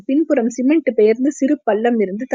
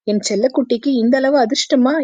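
ordinary delivery

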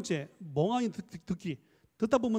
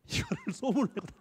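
higher-pitched, laughing through the words; amused laughing; 7 semitones higher than usual. Real, not acted. Lecturer tells of flattering rumour about himself, laughing. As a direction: break up laughing while telling it.

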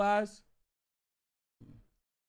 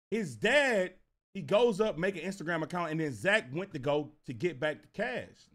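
normal talking voice

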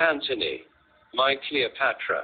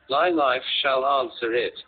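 normal talking voice

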